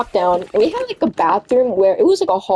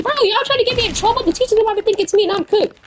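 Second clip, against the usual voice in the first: high, quick complaint; retold protest; 13 semitones higher, faster than usual. Kid to classmates getting him in trouble, high protest. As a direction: rush it out in a high, pleading pitch.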